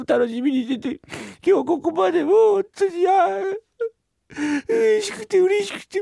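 high-pitched, hammed-up child's sobbing; fake crying. Boy guilt-tripping his father over a dumpling, fake crying. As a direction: wail theatrically with no real tears. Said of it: "涙が出てねえんだ、".

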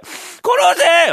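high, loud, building yell; shouting kill me. Acted. Man begs thief to kill him. (声を張り上げて「殺せ」と叫ぶ)

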